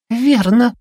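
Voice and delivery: cutesy, very high little creature voice; милый зверёк. A narrator's reading. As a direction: squeak it sweetly, very high. Милый зверёк-предательница отвечает Твайле. (писклявый милый голосок зверька)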